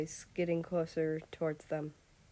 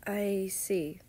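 whispered; 3 semitones higher than usual. A narrator's reading. Relieved prince answers quietly.

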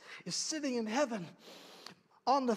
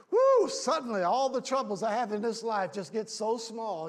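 ordinary talk, nothing marked

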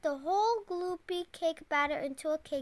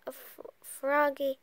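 ordinary delivery

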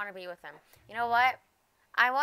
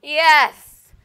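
excited cheering; 8 semitones higher, 14 dB louder than usual. Real, not acted. Freed from jail cheering.

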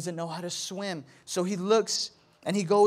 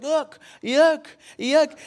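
high, loud toddler imitation; Cashy; 8 semitones higher, 8 dB louder, slower than usual. Toddler coming to dad, imitated high loud babble. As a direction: blurt it like a tiny child. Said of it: "Cashy with his pass, he's like".

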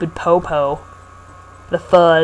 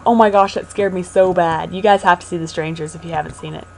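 ordinary delivery

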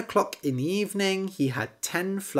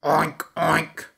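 pig oink. Acted. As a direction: grunt like a pig. Pig introduces itself.

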